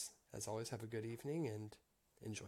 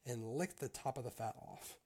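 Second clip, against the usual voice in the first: higher, louder read-aloud storytelling voice; story narration; 4 semitones higher, 4 dB louder than usual. A narrator's reading. Reader telling a Grimm tale to listeners, story narration. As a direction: read it out clearly as a fairy tale.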